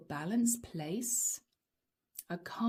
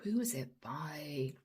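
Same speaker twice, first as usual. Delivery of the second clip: whispering; 5 semitones lower, 8 dB quieter than usual. Real, not acted. Asking spirits quietly mid-reading.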